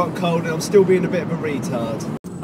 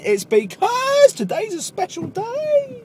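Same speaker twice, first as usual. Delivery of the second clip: high sing-song voice; 5 dB louder, slower than usual. Pretend.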